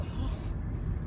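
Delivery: quiet acted sigh; reluctant sigh. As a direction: breathe out softly, mimicking reluctance. Reacting to therapist's advice, retold.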